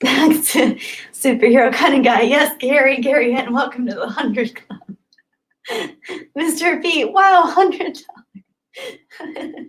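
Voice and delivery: high, laughing through the words; laughing delight. Real, not acted. Streamer thanking a big donor, laughing with delight. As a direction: giggle with joy. Reacting to hundred dollar donation.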